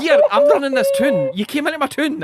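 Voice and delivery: high, loud laughing Glaswegian boast; Glaswegian vampire lord. Pretend. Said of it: "[name] is Strahd".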